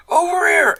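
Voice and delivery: high, loud call for attention; yell. Acted. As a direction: yell it out to be heard across the street. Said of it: "Okay, I yell".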